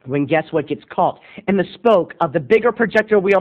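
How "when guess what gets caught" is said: usual speaking voice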